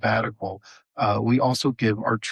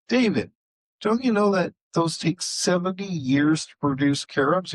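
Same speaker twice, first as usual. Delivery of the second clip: higher, mock-exasperated voice; lightly voiced Honi, pressing the point; challenging Honi; 7 semitones higher than usual.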